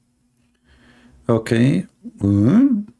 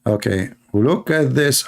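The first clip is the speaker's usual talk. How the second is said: ordinary talk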